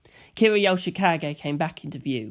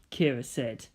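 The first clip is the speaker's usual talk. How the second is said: usual speaking voice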